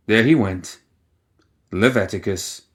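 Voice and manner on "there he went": ordinary delivery, nothing marked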